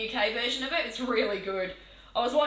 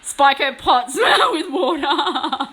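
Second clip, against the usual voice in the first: loud laughing scheme; 8 dB louder, much slower than usual. Real, not acted. Conspiring with chat.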